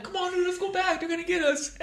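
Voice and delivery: high whiny panicked voice; squeaky mocking of the uncle; mock scared uncle. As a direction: whine like a scaredy-cat.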